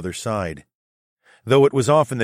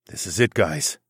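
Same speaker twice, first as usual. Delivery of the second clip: ordinary delivery, nothing marked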